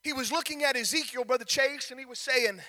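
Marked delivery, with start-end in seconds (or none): none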